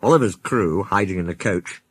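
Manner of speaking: ordinary delivery, nothing marked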